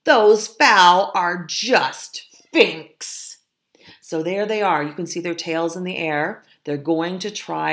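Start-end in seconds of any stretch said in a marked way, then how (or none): 0.0-3.4 high, loud, drawn-out rallying cry; loud bold cry. A narrator's reading. Fox leader rallying his family to the farmyard, crying out boldly. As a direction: cry out slowly like a fearless leader. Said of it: "Cried Frank".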